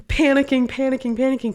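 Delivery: high, repeated burst of mock panic; storyteller's worked-up fluster; retold panic. Retelling her panic over a final.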